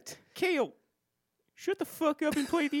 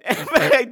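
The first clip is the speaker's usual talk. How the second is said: burst of laughter; 2 semitones lower, 10 dB louder than usual. Real, not acted. Laughs mid credit read.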